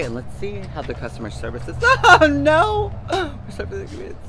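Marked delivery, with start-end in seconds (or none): 2.0-2.9 high squealing laugh; laughing shriek. Real, not acted. Surprised at busy store. 3.1-4.1 high squeal. Real, not acted. Reacting to busy store.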